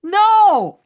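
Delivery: loud no. Real, not acted. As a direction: shout it down. Answer is wrong.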